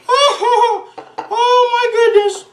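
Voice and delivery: very high, hooting falsetto squeal; hammed-up high-pitched amazement; falsetto delight. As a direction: whoop it out in comic falsetto. Clowning delight over crunchy coating.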